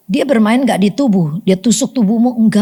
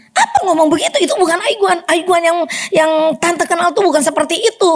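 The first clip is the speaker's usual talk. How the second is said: high-pitched indignant scolding; retold angry rebuke; 7 semitones higher than usual. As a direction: scold him, pitched well up. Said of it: "Ya saya marah lah".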